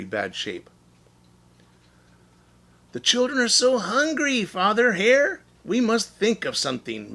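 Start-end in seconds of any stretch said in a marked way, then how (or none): 2.9-7.1 high, anxious mother's plea; pleading Missus Hare. Acted. Mother hare pleading with husband about hungry children, high and anxious. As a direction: beg your husband for food for the kids. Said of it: "Missus Hare cried one day".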